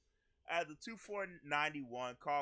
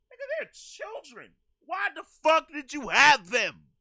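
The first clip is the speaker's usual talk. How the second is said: outraged; 11 semitones higher, 17 dB louder than usual. Real, not acted. Outraged reaction to the confession.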